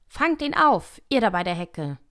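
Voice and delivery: rabbit calling out. A narrator's reading. Rabbit orders those by the hedge to catch him, calling loudly. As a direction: call out an order across the garden. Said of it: "dann die Stimme des Kaninchens allein".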